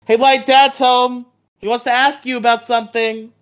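high, loud sing-out to someone at home; calling out. Acted. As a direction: imitate a sibling calling through the house. Voicing Sayu calling to Light.

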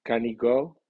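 "can he" run together as "canny", "he" unstressed; weak contracted pronoun. Shown as a demonstration. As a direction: say "can he go?" with a weak, linked "he", rising on "go". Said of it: "contrayéndose de la forma".

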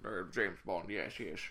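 low, soft suave spy; James Bond. Acted. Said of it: "he's like".

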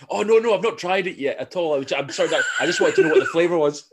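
high, loud, half-laughing backpedalling; acted flustered apology. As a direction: overdo the apologetic explanation. Acting flustered apology to waitress.

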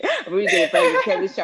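fast, high laughing words. Real, not acted. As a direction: laugh through the line. Laughing at her old self.

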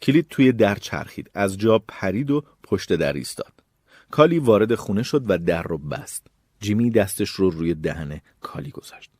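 plain reading voice

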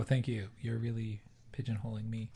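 ordinary delivery

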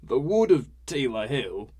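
put-on slow, loud drunken portent; dramatic and slow. A narrator's reading. Skivrin opening his tale to the newcomer, dramatic and slow. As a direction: draw it out like an ominous tale. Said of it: "he spoke dramatically and slowly".

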